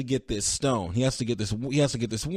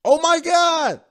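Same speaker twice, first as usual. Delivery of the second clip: much higher, louder oh my God; shocked; 7 dB louder, slower than usual. Real, not acted. Podcaster reacts to Gamora's fate, shocked exclamation. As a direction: blurt it out in real shock.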